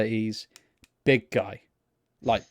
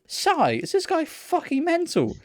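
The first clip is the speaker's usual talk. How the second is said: high-pitched, drawn-out disbelief; incredulous; 11 semitones higher, much slower than usual. Retold or imitated. Turning to Reese about the screamed sigh, incredulous. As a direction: retell it in exasperated, high-pitched astonishment.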